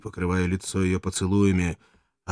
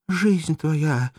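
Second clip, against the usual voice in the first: weak sad voice; 6 semitones higher, 3 dB louder, slower than usual. Acted. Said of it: "слабым печальным голосом".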